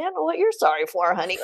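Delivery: playful shout, teasing the guys; flirty teasing. Retold or imitated. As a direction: call out to them, flirting. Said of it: "I was like".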